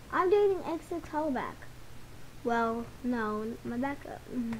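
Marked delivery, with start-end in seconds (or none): none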